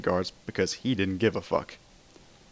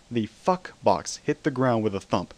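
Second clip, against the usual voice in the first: ordinary delivery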